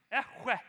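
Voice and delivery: "Echwe" with a throaty ch from the back of the mouth; Hebrew pronunciation. Shown as a demonstration. Teaching the congregation Hebrew, a throaty "Echwe". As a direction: say "Echwe", rasping the ch. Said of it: "you gotta get some saliva in you".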